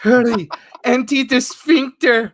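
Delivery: high, loud, slow goofy urgency; hammed-up urgent voice. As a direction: ham up a frantic command. Mock Markiplier voice, joking.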